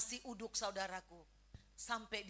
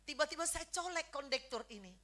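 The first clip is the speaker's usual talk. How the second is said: ordinary delivery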